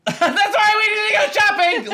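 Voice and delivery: very high loud laughing squeal; shrill psycho Rachel. Retold or imitated. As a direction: play her like a cheery psychopath. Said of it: "she was just like".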